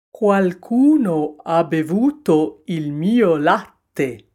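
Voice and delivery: slow, loud indignant bear; papa bear exclaiming. A narrator's reading. Papa bear finding his milk drunk, exclaiming. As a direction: boom it out slowly as the big bear. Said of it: "esclamò papà orso".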